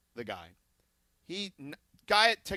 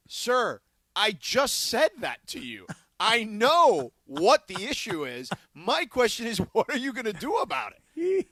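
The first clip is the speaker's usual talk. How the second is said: higher and louder, a retold angry complaint to support; exasperated demand; 5 semitones higher, 7 dB louder than usual. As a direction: press the rep hard on the phone, slowing down on "I know what the issue is". Retold complaint to customer service.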